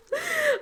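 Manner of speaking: a high tearful little laugh; high emotional laugh. Real, not acted. Teacher moved by her student's success, laughing high. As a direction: let out a touched laugh.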